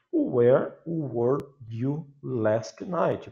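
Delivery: "Where were you last night" very slow and spelled out; teacher modelling the English question; English pronunciation demo. Shown as a demonstration. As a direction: drill the question word by word. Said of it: "Pronúncia, significado".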